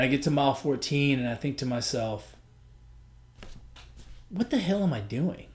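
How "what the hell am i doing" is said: exasperated self-talk. Retold or imitated. Inner voice at mile fourteen.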